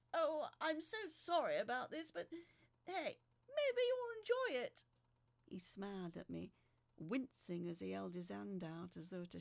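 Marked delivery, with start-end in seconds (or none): 0.1-4.8 higher, louder cheeky man's voice; cheeky John. Acted. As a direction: play John as a smug charmer. John's voice in the story.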